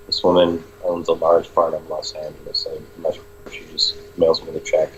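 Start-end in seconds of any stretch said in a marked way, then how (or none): none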